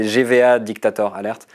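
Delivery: English name in a French accent. Shown as a demonstration. Guest repeating the account name so listeners follow, in a French accent. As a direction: say it the French way, with French vowels and R. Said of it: "Je le dis avec, euh, l'accent français".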